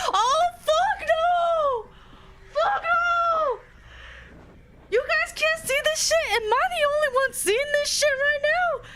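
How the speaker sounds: very high, frantic cries with nervous laughs; panicked yelling. Real, not acted. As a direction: beg to get out in a panic. Terrified by the scene, yelling.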